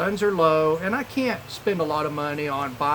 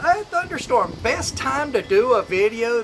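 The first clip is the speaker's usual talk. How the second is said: excited; 6 semitones higher, 5 dB louder than usual. Retold or imitated. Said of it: "So I thought".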